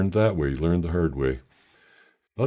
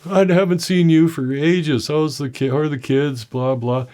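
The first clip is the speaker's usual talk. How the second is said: gushing cashier; 11 semitones higher, 5 dB louder than usual. Retold or imitated. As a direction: play the cashier suddenly thrilled to see an old friend. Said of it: "she goes".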